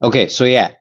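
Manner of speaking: ordinary delivery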